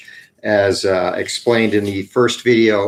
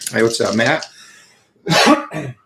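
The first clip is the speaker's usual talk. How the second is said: morning voice. Real, not acted. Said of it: "let me get my morning voice out of the way".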